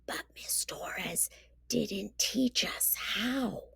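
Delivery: breathy hushed whisper; Izzy whispers the worry to Amato, hushed. A narrator's reading. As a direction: whisper it into a friend's ear. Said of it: "Izzy whispered into Amato's ear".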